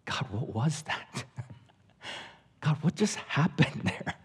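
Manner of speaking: soft, slow, stunned question; awed quiet prayer. Retold or imitated. Lying in the tent asking God, hushed and awed. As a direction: ask God in hushed wonder. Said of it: "And he just says".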